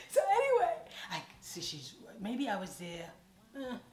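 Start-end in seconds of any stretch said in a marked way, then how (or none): 0.1-0.7 very high, loud through laughter; laughing reset. Real, not acted. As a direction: push past the giggles. Recovering from laughter.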